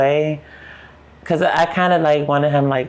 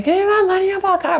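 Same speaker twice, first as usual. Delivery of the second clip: retold screaming; faster than usual. Woman chasing her robber in the rain, screaming high. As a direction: shriek after him as he runs off. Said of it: "I'm crying, screaming".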